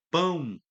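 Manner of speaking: Pão said with the final o as a U glide; pão with U sound. Shown as a demonstration. Teacher reading to students, showing the hidden U: spoken clearly. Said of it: "Ele traz esse som de U".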